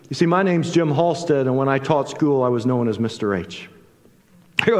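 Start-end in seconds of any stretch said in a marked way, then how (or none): none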